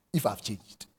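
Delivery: normal talking voice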